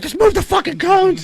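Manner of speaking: very high strained order; hammed-up high command; mock squeaky yell. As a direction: shriek the order. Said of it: "He's like".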